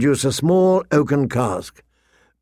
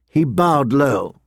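plain reading voice

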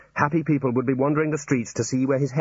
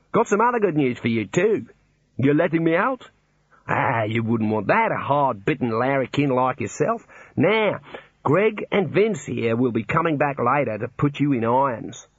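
Australian warder; 3 semitones higher than usual. Acted. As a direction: play the cheery Australian warder. Warder character continues.

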